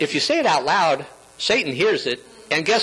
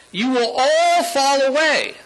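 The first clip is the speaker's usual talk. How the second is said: solemn warning in a raised pitch; Jesus; 7 semitones higher than usual. Acted. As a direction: proclaim it as a high, ringing prediction. Voicing Jesus' warning to disciples.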